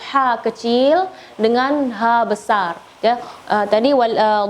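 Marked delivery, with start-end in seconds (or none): none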